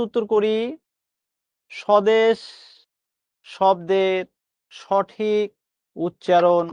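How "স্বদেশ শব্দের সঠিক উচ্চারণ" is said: slow question, a pause after each word; word-by-word reading. Shown as a demonstration. Teacher putting a quiz question to students, word by word. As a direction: read it out one isolated word at a time.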